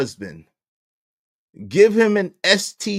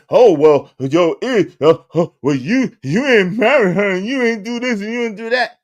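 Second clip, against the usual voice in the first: mocking excuse-makers; 2 semitones higher, 3 dB louder than usual. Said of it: "while people be".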